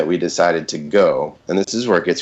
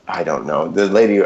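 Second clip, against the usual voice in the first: usual speaking voice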